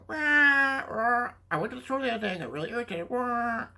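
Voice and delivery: high squawking parrot voice, fast; mock parrot parroting the words word for word; bad parrot impression. Shown as a demonstration. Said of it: "That was a bad impression".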